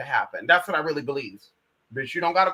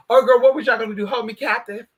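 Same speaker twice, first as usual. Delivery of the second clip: raised, mildly mock-outraged questioning; indignant challenge; 4 semitones higher, 6 dB louder than usual. Confronting the imagined plotters, mildly indignant. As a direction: call them out with some indignation. Indignant at suspected plot, first take.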